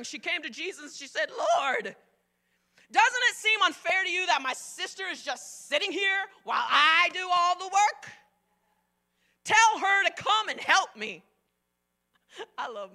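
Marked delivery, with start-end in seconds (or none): 1.3-11.2 whiny Martha. Retold or imitated. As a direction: whine like an overworked sister. Martha complaining to Jesus.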